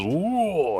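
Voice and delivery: high, drawn-out whoa; acted startled whoa. Luffy scooped up by his ally, high startled whoa. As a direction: cry out as if swept up. Character cries out in retelling.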